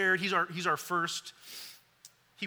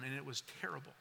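softer, trailing down in pain; quiet, pained; 4 semitones lower, 13 dB quieter, much faster than usual. Real, not acted. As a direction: let the pain pull the voice down. Painful memory, voice sinking.

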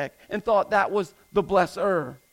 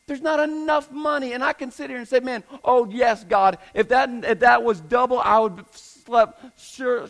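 preaching at full force; 5 semitones higher, 4 dB louder than usual. Acted. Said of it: "sermon climax".

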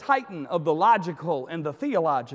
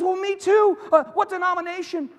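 high, loud, eager rescuer; delighted to find a match; pitched-up, hurried enthusiasm; mounting excitement; 11 semitones higher, 6 dB louder than usual. Acted.

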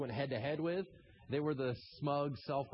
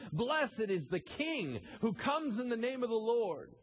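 raised, jubilant crowd acclamation; proclaimed shout; 7 semitones higher than usual. Retold or imitated. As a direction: cry it out like a crowd hailing a king. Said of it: "they shouted".

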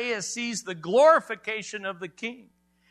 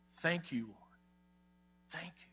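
very soft thanks to God; hushed prayer; 3 semitones lower, 13 dB quieter than usual. Acted. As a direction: drop almost to nothing, praying gratefully.

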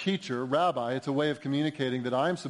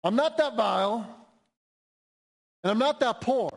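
much higher, mocking self-assured protest; self-righteous inner voice; 9 semitones higher, 4 dB louder than usual. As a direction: imitate a proud person brushing off their need. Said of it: "keep saying to yourself".

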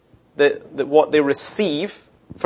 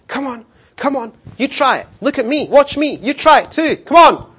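higher coaxing parent eagle call; 8 semitones higher than usual. Retold or imitated. Mother eagle coaxes her young to try flying, coaxing. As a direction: coax the young to fly.

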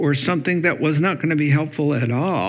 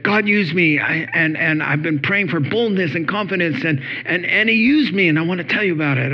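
higher-pitched, gushing retold testimony; excited student; 4 semitones higher than usual. Student bursting to tell her pastor her testimony, excited. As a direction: imitate her bubbling over, tumbling into the news. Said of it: "And she's all excited".

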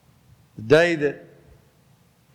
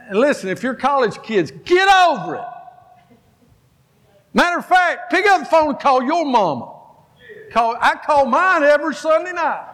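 preaching at full force; 11 semitones higher than usual. Acted. Pastor urging college kids to call their mothers, fiery preaching. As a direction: drive it home hard at the students.

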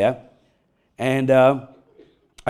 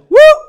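sudden high, loud whoop; prankster's whoop; 12 dB louder than usual. Retold or imitated. Husband springing the spider on his wife, prankster's whoop. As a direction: let out a short piercing "whoop".

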